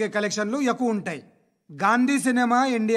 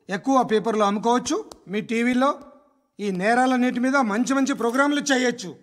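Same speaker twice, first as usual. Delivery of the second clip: ordinary talk, nothing marked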